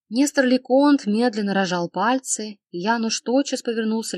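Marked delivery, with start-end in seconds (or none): none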